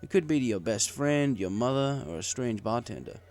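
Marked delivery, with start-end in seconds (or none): none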